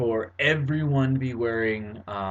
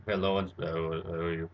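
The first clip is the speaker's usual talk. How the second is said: vocoder greeting, lower; 6 semitones lower, 5 dB quieter than usual. Shown as a demonstration. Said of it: "maybe you have, like".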